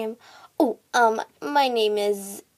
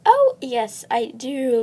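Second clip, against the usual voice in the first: ordinary delivery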